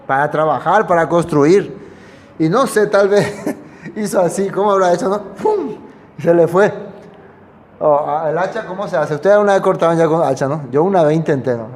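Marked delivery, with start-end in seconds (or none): 5.3-5.7 a sharp, high "¡Pum!" splash; playful sound effect; mock splash noise. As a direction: pop it out high like the axe hitting water. Axe splashing into the water.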